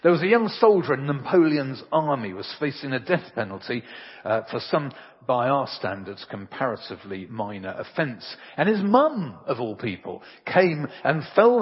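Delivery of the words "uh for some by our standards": ordinary delivery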